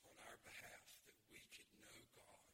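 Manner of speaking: low, soft, unhurried prayer; hushed prayer with pauses between phrases; public prayer. Real, not acted. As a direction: talk quietly to God on the congregation's behalf. Leads an opening prayer.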